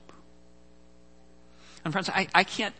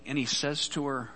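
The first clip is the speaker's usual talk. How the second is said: ordinary talk, nothing marked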